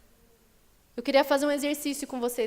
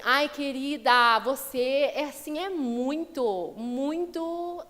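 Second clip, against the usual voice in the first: slow, drawn-out gushing "muito, muito top"; mock a syrupy flatterer; gushing fake praise; much slower than usual. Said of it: "Fala assim".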